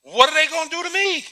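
high, fast, panicky plea; a scared follower pleading not to be caught; frightened disciple. Acted. Preacher voicing a frightened disciple, high and fast. Acted disciple afraid of arrest.

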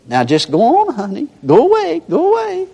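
very high cooing pet voice; sweet talk to a dog. Retold or imitated. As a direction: coax the puppy in a high coo. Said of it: "talking to a little dog".

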